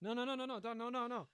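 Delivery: high, rapid-fire frantic denial; pleading squeal to the boss, play-acted; mock panic. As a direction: back down in hammed-up terror. Acts out backing down in fear.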